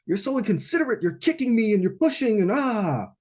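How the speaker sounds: hammed-up grumbling at rude dancers; mock indignation. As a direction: huff at the inconsiderate crowd. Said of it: "I'm, like, protecting myself".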